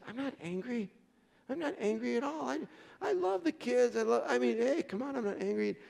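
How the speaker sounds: high-pitched, mock-defensive husband; protesting denial. Husband denying to his wife that he's angry, high defensive protest. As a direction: insist on it, pitch climbing as you protest.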